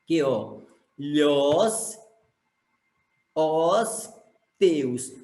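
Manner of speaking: "Que, ó, lhos, os teus" in isolated chunks, long pauses between; separated poetic syllables. Shown as a demonstration. Teacher divides the second verse for pupils, "Que, ó, lhos, os teus" in separate syllables. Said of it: "junto numa sílaba só aqui".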